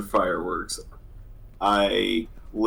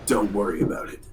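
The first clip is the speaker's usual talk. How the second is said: rough sore-throat voice; faster than usual. Real, not acted. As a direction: talk through a sore throat. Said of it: "like, a Solid Snake voice?".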